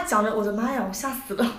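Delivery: usual speaking voice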